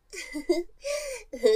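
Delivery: high teasing giggle falling off; mocking giggle. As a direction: laugh off his excuse. Mocking his refusal. (からかうように笑う)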